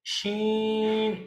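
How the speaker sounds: slow "शीन", the sh sound drawn out; demo of sheen. Shown as a demonstration. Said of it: "middle part of tongue touch". (धीमा, लंबा "शीन")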